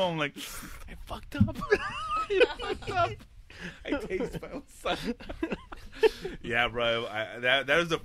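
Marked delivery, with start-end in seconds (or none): 0.4-1.6 slow, high, strained near-whisper; voiceless mock-guilty squeak; choked confession. Confessing to his mom, retold. 1.6-5.1 high, slow, squeezed through laughter; wheezy real laughing confession; helpless laughing. Real, not acted. Cracking up at his own confession.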